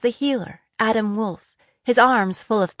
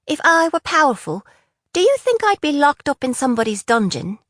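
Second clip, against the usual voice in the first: higher, lightly voiced young woman; a hint of a captive girl pushing back; bitter young prisoner; Jenna, defiant; 6 semitones higher, 5 dB louder than usual. A narrator's reading. Jenna's voice in dialogue.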